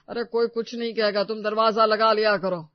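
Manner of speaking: loud father-in-law giving advice. Acted. Story figure: father-in-law.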